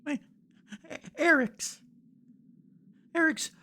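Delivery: put-on a little boy telling how his family was killed; high, broken child voice recounting the raid; grieving young boy.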